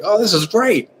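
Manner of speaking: a fan happily watching the fight; mock delight. Crowd enjoying an MMA fight.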